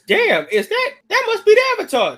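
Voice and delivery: radio announcer spotting the Avatar, excited. Retold or imitated. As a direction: rattle it off like a thrilled announcer. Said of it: "out the radio is".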